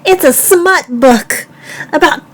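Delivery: high, loud, disbelieving outburst; exasperated shout. Real, not acted. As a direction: yell it in fed-up disbelief. Exasperated rebuttal to parody.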